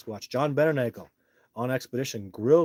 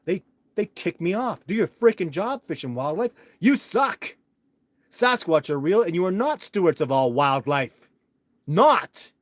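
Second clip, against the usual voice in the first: higher, louder, furious ranting; real angry outburst; 6 semitones higher, 8 dB louder than usual. Real, not acted. Venting at Fish and Wildlife, furious shouting. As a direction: yell at the agency to do its job. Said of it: "I'm not apologizing for that".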